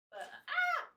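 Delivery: sudden high yelp of alarm; a sharp surprised "ah!" as the paper nearly tears; startled cry. Real, not acted. Paper almost rips while wrapping, startled cry.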